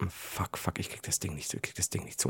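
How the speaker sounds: frantic whispered swearing; whispering to himself, stuck at the oven door; whispered panic. Retold or imitated. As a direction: hiss it under your breath in a panic. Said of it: "der immer so".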